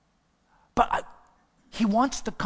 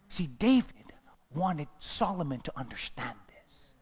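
slow, hushed whisper; 6 semitones lower, 7 dB quieter than usual. Real, not acted. Preacher whispers a key point to the congregation. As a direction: whisper it slowly to draw them in.

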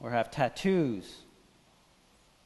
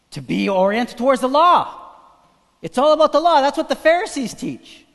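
loud and high, driving the sermon home; stirred-up pulpit voice; fiery preaching; 7 semitones higher, 9 dB louder than usual. Acted. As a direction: preach it full force to the crowd. Preaching at full force.

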